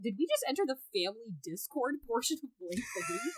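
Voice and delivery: usual speaking voice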